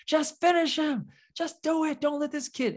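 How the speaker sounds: high, loud mock shouting crowd; crowd yelling. Retelling a Dragon Ball fight to co-hosts, mock crowd yelling. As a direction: yell it like the whole crowd urging him. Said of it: "everyone was like".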